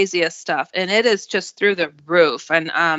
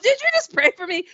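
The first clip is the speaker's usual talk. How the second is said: mock-incredulous question; 11 semitones higher than usual. Joking reaction to friend's prayer.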